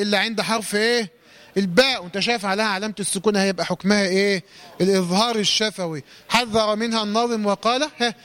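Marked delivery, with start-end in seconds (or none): none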